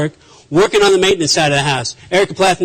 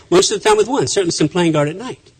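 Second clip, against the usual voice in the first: ordinary talk, nothing marked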